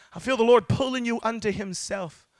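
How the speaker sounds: normal talking voice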